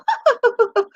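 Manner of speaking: very high squeaky mimicked laugh; parrot laugh. Owner imitating how her parrot copies her laugh. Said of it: "and he'll go".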